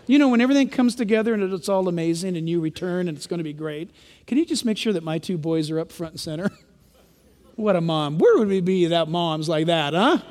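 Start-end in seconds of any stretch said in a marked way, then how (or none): 4.3-6.5 lower, softer, coaxing mom voice; lightly voiced sweet-talking mother; a hint of a doting mother asking a favour; mock mom request. Mom quote again.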